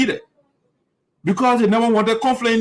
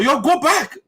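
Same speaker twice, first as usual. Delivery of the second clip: higher, curt order to go away; rude dismissal; 7 semitones higher, slower than usual. Retold or imitated. Quoting the man dismissing the elder, rude. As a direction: shoo the elder off. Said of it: "The man telling the elder".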